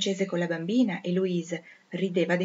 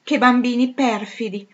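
higher, louder, slow mock scolding; mock old governess; 6 semitones higher, 9 dB louder, slower than usual. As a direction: ham up the old governess's outrage. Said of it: "la povera vecchia ci diceva".

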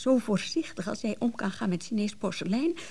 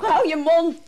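louder, cutting rebuke; sharp retort; 4 semitones higher, 6 dB louder than usual. Acted. Wife snapping at her husband to shut up. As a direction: snap it at him. Quarrel, telling him to shut up.

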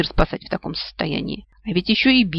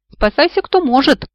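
much higher, loud alarm yell; panicked yell; 9 semitones higher, 6 dB louder than usual. A narrator's reading. Spinal cord to the whole body, panicked yell. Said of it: "заорал спинной мозг".

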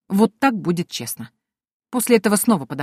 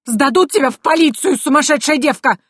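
higher, louder shouting threat; furious yell; 8 semitones higher, 5 dB louder than usual. Acted. Priest dangling from ladder yelling threats, high loud. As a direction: scream it at her.

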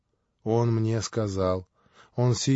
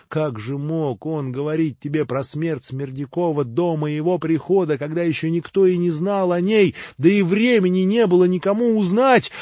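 rising louder and higher, frightened; fearful urgency; 6 semitones higher, 5 dB louder than usual. A narrator's reading. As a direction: try anxiously to reason with him. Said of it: "замирая от страха". (замирая от страха)

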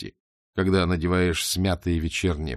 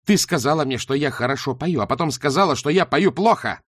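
angry accusation; 5 semitones higher, 4 dB louder than usual. Acted. Shayana accusing Beryl.

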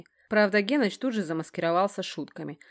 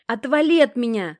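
high, loud, trembling shout; upset cry; 6 semitones higher, 9 dB louder than usual. A narrator's reading. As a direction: cry out as if close to tears. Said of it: "крикнула она дрожащим голосом".

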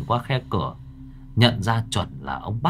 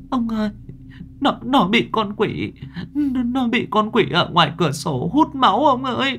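put-on much higher tearful little girl's voice; whimpering child; 13 semitones higher than usual. A narrator's reading. Nhi tearfully tells grandfather about the demon, whimpering. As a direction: blubber it out like a frightened child. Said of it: "con Nhi mếu máo".

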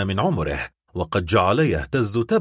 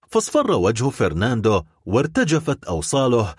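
usual speaking voice

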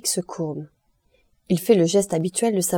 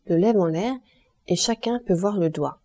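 ordinary delivery

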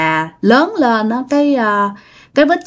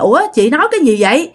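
high, fast, shocked denial; startled protest; 7 semitones higher than usual. Retold or imitated. Startled mother insisting the baby is hers, high and fast. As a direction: push back in alarm, words tumbling out. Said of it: "bà Mộc mới hết hồn".

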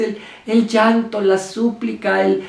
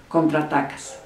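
normal talking voice

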